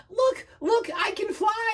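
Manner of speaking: very high, thrilled exclamation; squeaky-high excitement; gasping. Acted. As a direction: burst out in amazed delight. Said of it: "he gasped".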